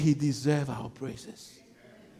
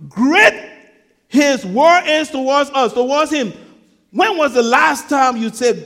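loud, raised sermon delivery with weighty pauses; impassioned preaching; 6 semitones higher, 10 dB louder than usual. Real, not acted. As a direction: preach it with rising force. Preaches at full force.

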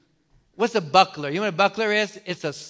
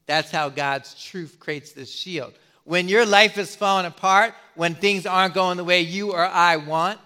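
normal talking voice

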